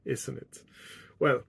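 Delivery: usual speaking voice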